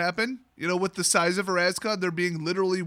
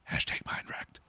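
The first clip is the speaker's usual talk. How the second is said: breathy whispered hashtag; whispered aside; 8 dB quieter than usual. Shown as a demonstration. Whispering a hashtag punchline after the recap. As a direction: drop to a whisper for the joke.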